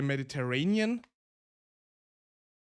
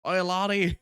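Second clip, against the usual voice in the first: loud, higher mock-Scottish call; Scottish accent; 4 semitones higher, 5 dB louder than usual. Shown as a demonstration. Streamer playing a Scotsman hailing someone, loud Scottish accent. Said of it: "I love the Scottish accent".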